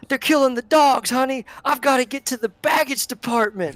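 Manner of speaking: high, loud panicked voice; frantic old guard. Acted. Hardro to the conductor, high frantic worry. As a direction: rush it out like a flustered old-timer.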